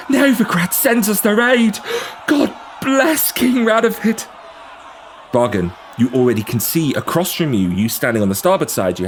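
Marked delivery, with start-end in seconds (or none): 0.1-4.3 high loud peasant woman, slow at the end; woman yelling. Acted. Woman in the crowd to the barge, high yelling. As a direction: cry out in desperate hope. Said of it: "A woman yells".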